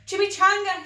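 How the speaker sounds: high, loud desperate call; a loud frantic shout into the bushes; cried out. Acted. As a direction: call out across the garden. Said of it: "he cried".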